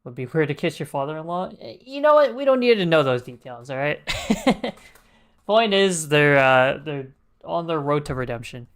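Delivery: ordinary talk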